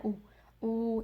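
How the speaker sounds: ऊ held long for two counts; two-count stretch. Shown as a demonstration. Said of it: "इस तरह आपने इसको पढ़ना है".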